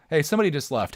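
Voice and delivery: usual speaking voice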